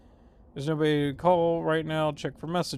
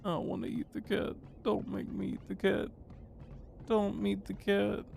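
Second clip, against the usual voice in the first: small, quiet, higher-pitched plea; soft pleading; 4 semitones higher, 7 dB quieter than usual. Pretend. Player begging not to eat the cat, soft mock plea. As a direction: beg under your breath, pitched up.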